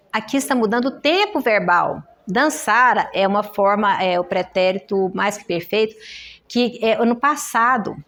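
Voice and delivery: ordinary delivery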